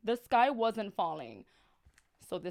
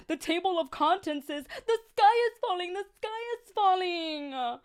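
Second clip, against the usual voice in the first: alarmed warning; 8 semitones higher, 4 dB louder than usual. Acted. Jack warns the birds.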